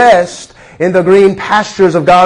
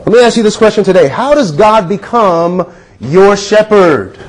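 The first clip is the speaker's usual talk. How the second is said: ordinary delivery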